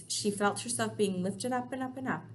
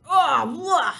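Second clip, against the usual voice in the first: loud high yuck of disgust; disgusted roar; 7 semitones higher, 11 dB louder than usual. Acted. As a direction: spit out a roar of revulsion. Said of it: "roared the blood bottler".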